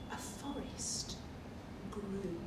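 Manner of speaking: soft hushed reveal, nearly whispered; hushed storytelling. A narrator's reading. Reader hushing the children for the magic moment, whispered. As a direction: breathe it out like a secret.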